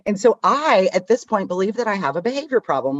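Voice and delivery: ordinary talk, nothing marked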